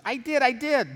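acted earnest self-defence; eager protest. Housekeeper protests to her employer, eager high insistence.

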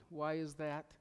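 ordinary talk, nothing marked

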